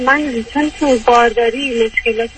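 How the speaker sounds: ordinary talk, nothing marked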